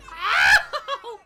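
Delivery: high loud laughing ow; laughing yelp. Real, not acted. Hit in game, laughing shout.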